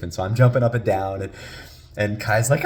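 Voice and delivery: ordinary delivery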